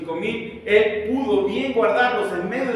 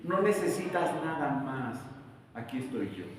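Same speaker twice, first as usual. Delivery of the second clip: gentle reassurance; 4 semitones lower, 8 dB quieter than usual. Acted. God speaking gently.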